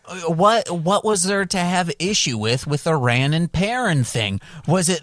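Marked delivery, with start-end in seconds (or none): none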